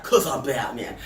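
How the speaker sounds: quiet gruff Batman impression; movie-parody quote, low mock Batman voice. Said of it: "how it should have ended, where Batman's like".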